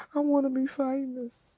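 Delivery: high soft whimpering longing; high whimpered wish; wistful whine. Real, not acted. Repeated whimpering.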